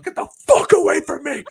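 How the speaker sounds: high, loud barked dismissal; mock angry shout. Wrestler shoving off a fan, mock angry shout. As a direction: yell him away. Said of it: "he just goes".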